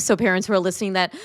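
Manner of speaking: ordinary talk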